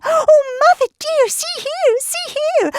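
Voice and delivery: very high little kitten voice; high-pitched kittens crying out. A narrator's reading. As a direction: call out to mother in a tiny squeak. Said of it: "they began to cry".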